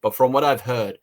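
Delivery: ordinary talk, nothing marked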